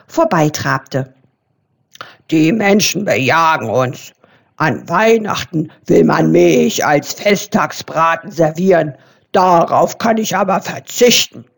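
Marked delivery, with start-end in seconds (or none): 2.3-11.5 a hint of a lower boar voice; indignant boar, lightly voiced; lightly voiced grunting boar. A narrator's reading. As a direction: grumble it like a wary boar. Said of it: "grunzte Wildschwein Eberhard".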